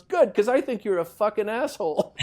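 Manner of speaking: high, loud, laughing through the punchline. Retold or imitated. Golfer retells Hobday's punchline to his co-host, laughing and loud. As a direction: blurt the insult while cracking up.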